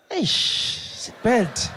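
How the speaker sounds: loud, tutting cop; disapproving cop. Retold or imitated. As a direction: cluck your tongue at the driver. Cop clicks at driver.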